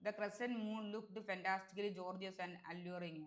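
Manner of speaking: very slow, deliberate read of the sentence. Shown as a demonstration. Teacher reading an exam sentence slowly to students. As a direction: read each word slowly. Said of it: "exam sentence read slowly".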